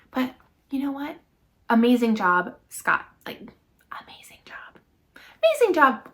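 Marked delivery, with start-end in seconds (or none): 3.2-4.8 unvoiced whisper of praise. Real, not acted. Whispered then loud repeat. 5.1-6.1 excited praise. Real, not acted. Whispered then loud repeat.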